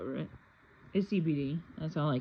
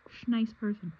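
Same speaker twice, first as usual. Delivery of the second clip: catching a swear; 6 semitones higher, 9 dB louder than usual. Real, not acted. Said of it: "I wasn't gonna say sh".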